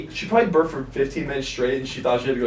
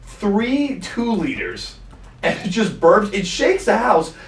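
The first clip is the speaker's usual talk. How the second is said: slow emphatic; 3 semitones higher, 3 dB louder, slower than usual. Real, not acted. Describing stepmom belching.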